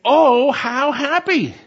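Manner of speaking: slow, high, drawn-out joyful cry of Oh, how happy!; rapturous, slowed exclamation; emphatic exclamation. Shown as a demonstration. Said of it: "is actually emphatic".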